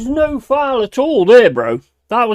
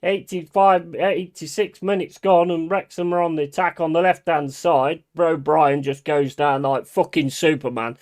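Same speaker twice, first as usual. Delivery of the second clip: ordinary talk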